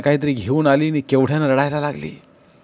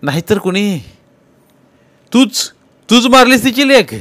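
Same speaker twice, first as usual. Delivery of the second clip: loud, very high accusing yell; furious accusation; 11 semitones higher, 8 dB louder than usual. Acted. Tukaba furiously accuses Nana of killing. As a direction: hurl the accusation at him. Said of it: "तुकाबाचा राग बाहेर पडला".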